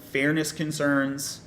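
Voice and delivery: ordinary delivery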